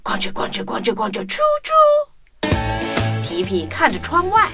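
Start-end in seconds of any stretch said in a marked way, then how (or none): none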